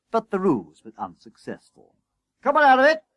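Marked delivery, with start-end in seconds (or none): 2.4-3.0 bossy shout, high and loud; loud, high-pitched summons; calling out. A narrator's reading. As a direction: holler an order across the yard. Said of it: "called Coupling".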